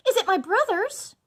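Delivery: high, loud eager question; hopeful urgency. A narrator's reading. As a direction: ask with desperate hope. Wife hopes for rescue.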